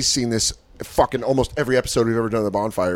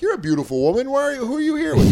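mock flattering comic; 11 semitones higher than usual. Mocking crowd work flattery.

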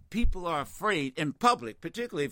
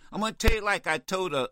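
usual speaking voice

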